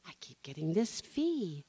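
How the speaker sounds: hushed whisper of a complaint. Retold or imitated. Confiding the fee problem to the teller in a whisper.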